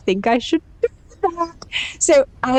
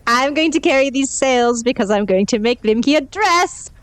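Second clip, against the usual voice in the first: ordinary talk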